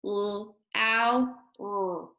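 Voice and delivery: each sound said separately: L, ow, r. Shown as a demonstration. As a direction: sound out L, ow, r one by one, like a teacher. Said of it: "Let's go through and make the sounds".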